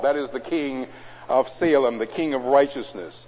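ordinary talk, nothing marked

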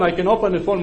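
normal talking voice

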